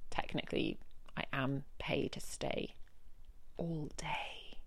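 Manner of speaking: grudging whispered aside; resigned whisper. Real, not acted. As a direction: trail off into a whisper. Resigned about working late.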